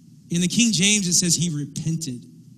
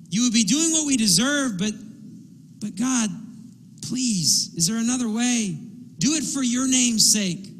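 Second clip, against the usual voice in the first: raised, urgent pleading with God; desperate plea; 6 semitones higher than usual. Retold or imitated. Preacher voicing Moses bargaining for the people, raised urgent plea. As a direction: bargain with God, voice lifted and pressing. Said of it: "He's desperate".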